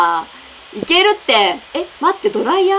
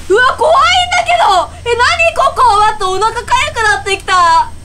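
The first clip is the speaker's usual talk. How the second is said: real scared shriek; 9 semitones higher than usual. Real, not acted. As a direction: squeal in real fright. Creepy room frightens player.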